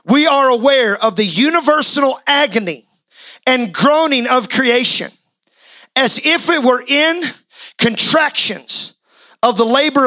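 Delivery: measured, solemn reading of the verses; scripture reading. Acted. Preacher reading Romans to the church, measured and solemn. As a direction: read the passage slowly to the congregation.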